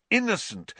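usual speaking voice

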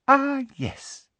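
very high, pleased little coo; simpering. A narrator's reading. Umbridge finding the parchment in her handbag, simpering. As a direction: sing it out high and sweet.

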